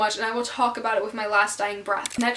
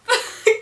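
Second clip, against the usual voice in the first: high giggle; 12 semitones higher, 6 dB louder than usual. Real, not acted. Laughs at own slip.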